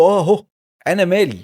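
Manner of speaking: loud, high snapping retort; mock angry retort. Father's angry retort quoted.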